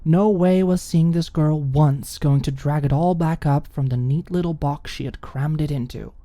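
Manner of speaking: ordinary delivery, nothing marked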